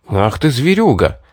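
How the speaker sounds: mildly hissed reproach; understated furious hiss; angry hiss. A narrator's reading. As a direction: spit it out in resentment. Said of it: "прошипел я".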